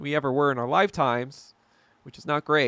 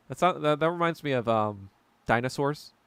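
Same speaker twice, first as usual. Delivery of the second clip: ordinary talk, nothing marked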